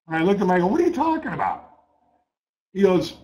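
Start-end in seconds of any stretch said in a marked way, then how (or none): 0.7-1.7 high, fast, incredulous outburst; can't believe what he's hearing; stunned disbelief. Retold or imitated. Trader reacting to news of a five-million loss, high and fast in disbelief.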